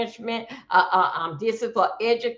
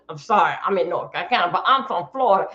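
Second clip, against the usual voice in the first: ordinary talk, nothing marked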